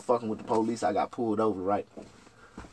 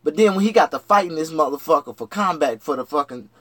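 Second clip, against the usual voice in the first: ordinary delivery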